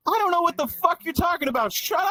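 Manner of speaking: high-pitched exasperated outburst; yelling at someone. Real, not acted. Comic interrupted by someone in the crowd, yelling. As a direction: shut the heckler down, pitch way up. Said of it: "I'm sorry I yelled at you".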